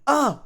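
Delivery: short high, loud yelp; startled high "ah"; surprised cry. Acted. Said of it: "Zuko said in surprise".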